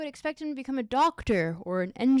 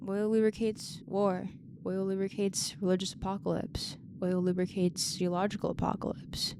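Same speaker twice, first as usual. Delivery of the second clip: lower, breathy whisper as the climax; dramatic whisper; 6 semitones lower, 3 dB quieter than usual. Acted. Narrator ending the oil litany, ominous whisper. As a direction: drop to a creepy hushed whisper.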